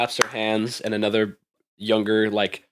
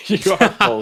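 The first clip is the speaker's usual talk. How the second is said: laughing; 9 semitones higher, 6 dB louder than usual. Real, not acted. GM laughing mid announcement.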